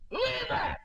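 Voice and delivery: high, loud frantic yell; frantic shout. Retold or imitated. Man in hell shouting after the woman. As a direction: cry out the question in panic. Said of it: "And he's saying".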